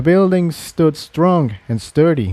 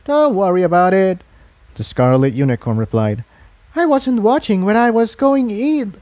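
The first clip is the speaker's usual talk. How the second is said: friendly unicorn voice, much higher; scarlet unicorn; 8 semitones higher than usual. Acted. Unicorn reassuring the mare she bumped, friendly. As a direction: brush it off kindly.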